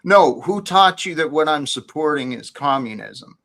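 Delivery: ordinary talk, nothing marked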